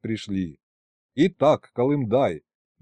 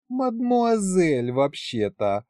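slow languid purr, drawn out; breathy hollywood starlet; 6 semitones higher, much slower than usual. A narrator's reading. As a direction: drawl it like a sultry starlet. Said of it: "томно протянула бесовка". (томный протяжный голос соблазнительницы)

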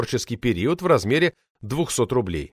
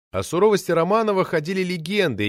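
usual speaking voice

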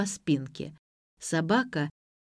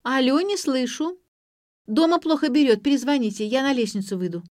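higher voice for a bad line; raised phone voice; 7 semitones higher, 5 dB louder than usual. A narrator's reading. Granddaughter tells caller the line is bad, pitched up.

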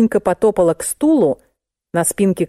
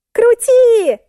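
very high, loud squeal; 5 dB louder than usual. A narrator's reading. Musenka screams at narrator to keep pedalling, squealing. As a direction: shriek the command in panic. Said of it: "завизжала Мусенька".

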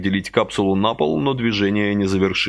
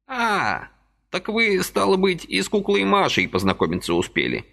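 put-on high old man's voice, exclaiming; an old man crying out in recognition; old man realising Vasily met doll Masha, high exclamation; 11 semitones higher than usual. A narrator's reading. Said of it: "вскричал он".

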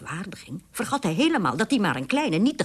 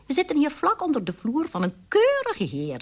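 widow mouse voice; 10 semitones higher, 4 dB louder than usual. Acted.

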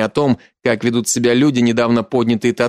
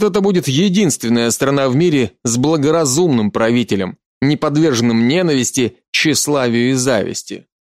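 plain reading voice

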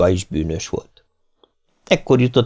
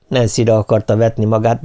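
plain reading voice

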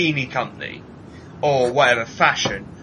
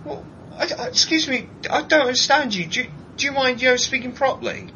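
much higher, fussy put-on voice; mock prissy reply; 10 semitones higher than usual. Mocking his own reaction to the Brummie accent, prissy high voice. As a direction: play a prim person who can't follow the accent. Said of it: "I was just like".

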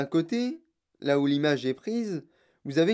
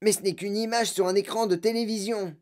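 higher-pitched scoffing; a hint of a derisive kid; lightly voiced mocking boy; 5 semitones higher than usual. A narrator's reading. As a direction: object as an amused boy, pitch up. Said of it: "demanda en riant Mike T V".

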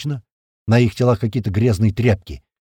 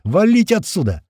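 raised-pitch urgent warning; urgent shout; 7 semitones higher than usual. A narrator's reading. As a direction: call to get out now. Urgent shout during the standoff. (тревожный выкрик)

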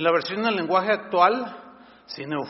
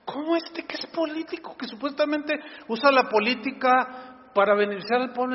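indignant; 7 semitones higher than usual. Real, not acted. Indignant rhetorical question.

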